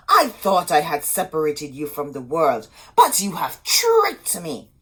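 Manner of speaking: loud, bitter accusation; sorceress's loud reproach; angry witch. A narrator's reading. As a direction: scold her for the betrayal. Sorceress scolds Rapunzel.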